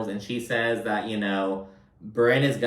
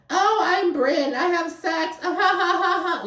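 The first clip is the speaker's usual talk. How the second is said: very high, squealing mock voice with fake laughter; Bryn. Podcaster ridiculing a castmate's lowbrow humor, high mocking impression.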